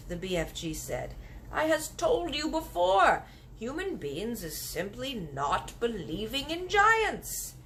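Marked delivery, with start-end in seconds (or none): 1.5-7.5 slow, higher gentle giant insisting; drawn out kindly giant. Acted. Giant insisting to the girl, slow. BFG voiced in story.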